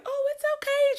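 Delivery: high, soothing imitated Texas accent. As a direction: comfort someone in a put-on high Texas voice. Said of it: "her little Texas accent".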